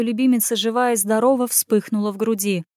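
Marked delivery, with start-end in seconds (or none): none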